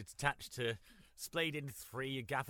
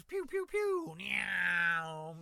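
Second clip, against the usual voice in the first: silly sound effects; 4 semitones higher, much slower than usual. Pretend. Silly sound effects in news bit.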